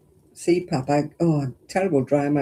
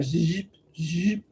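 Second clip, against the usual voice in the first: imitating a zip; 6 dB quieter than usual. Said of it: "It was like".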